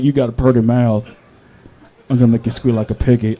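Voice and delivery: low, lightly voiced movie hillbilly; Deliverance villain impression. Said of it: "third part of Deliverance when he's like".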